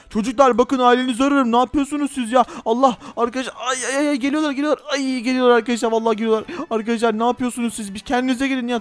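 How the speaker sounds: ordinary talk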